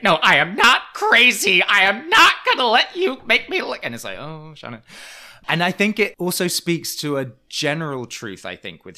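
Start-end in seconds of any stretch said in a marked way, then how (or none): 0.0-3.8 high, loud hammed-up screech; screeching Shannon. Imitating housewife driven mad by the others, screeching protest. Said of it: "being this screeching mess". 4.2-4.8 soft, higher sigh of pity; hushed mock disappointment. Imitating onlookers reacting to housewife's outburst, soft mock pity.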